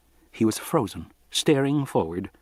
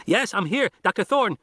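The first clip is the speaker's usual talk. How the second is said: put-on much higher young boy's voice, pressing; teen boy warning the doctors over the radio, high and urgent; urgent kid on the radio; 10 semitones higher than usual. A narrator's reading. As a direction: play the boy pleading to get in the car. Arby answers over radio.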